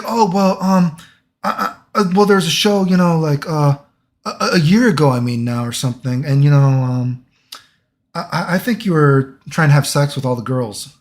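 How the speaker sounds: mock nervous boss lady, hemming and hawing; flustered boss lady. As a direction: stammer out the accusation like a nervous manager. Flustered boss lady voiced, accusing.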